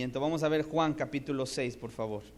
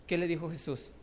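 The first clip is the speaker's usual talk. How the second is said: normal talking voice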